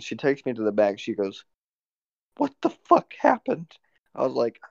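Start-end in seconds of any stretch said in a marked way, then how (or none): 2.4-3.7 high, drawn-out mock outrage; imitated manager demanding answers; manager's outraged voice. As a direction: confront in a high put-on voice. Manager confronts him in retold scene.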